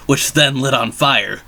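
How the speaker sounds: ordinary delivery, nothing marked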